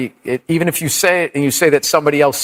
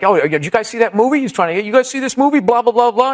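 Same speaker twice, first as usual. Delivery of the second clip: higher, lightly hammed-up comic fishing for recognition; mock comic patter; 7 semitones higher, faster than usual. Bombing comic to the crowd, eager mock patter. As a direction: imitate a comic working the room, pitched up.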